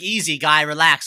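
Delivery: acted exasperated comeback; loud retort. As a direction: push it out loud to cut off a critic. Acted reply to online critic.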